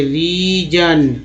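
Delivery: रीजन slowly, as "ree" then "jan"; broken into parts. Shown as a demonstration. Teacher showing learners gn as jan, रीजन slowly split. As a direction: draw out both halves of रीजन. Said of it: "तोड़ तोड़ के कैसे पढ़ेंगे".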